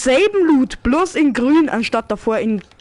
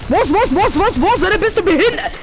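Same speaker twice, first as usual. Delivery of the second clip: raging gamer; 6 semitones higher than usual. Real, not acted.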